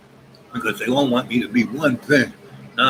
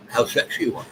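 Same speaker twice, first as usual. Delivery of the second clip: ordinary delivery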